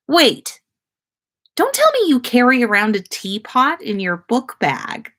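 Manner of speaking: amused disbelief. A narrator's reading. Surprised girl.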